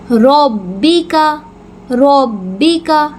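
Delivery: ربی کا very slowly with a strong doubled ب and heavy ر; firm doubled ب. Shown as a demonstration. Teacher models ربی کا slowly with firm ب. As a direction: press the ب firmly and slowly. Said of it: "مضبوطی کے ساتھ پڑھیں گے".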